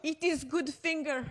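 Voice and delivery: high-pitched mock Russian accent; put-on Russian accent; bad Russian accent. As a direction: joke in hokey Russian English. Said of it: "this really bad Russian accent".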